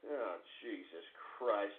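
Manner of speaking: very low, soft, slow groan; quiet, fed-up muttering; deflated exasperation. Real, not acted. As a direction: sigh it out, spent after a tirade. Deflated after screaming.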